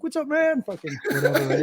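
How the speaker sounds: mock surfer-dude hello; laid-back bro greeting. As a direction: play the chill guy saying hi. Retelling friend's casual greeting.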